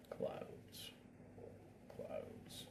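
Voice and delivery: hushed, breathy repetition of clouds; whispered mantra. Shown as a demonstration.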